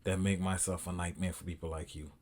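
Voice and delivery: low, very soft menacing monotone; movie threat imitation. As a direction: quote it like a quiet, deadly phone threat. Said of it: "you see the movie Taken?".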